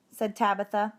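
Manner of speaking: usual speaking voice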